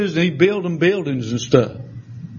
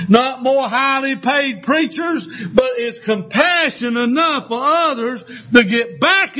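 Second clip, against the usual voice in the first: building revival sermon cadence; preaching; 5 semitones higher than usual. Acted. Preacher urging his congregation back to compassion. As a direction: preach it like a call to repentance.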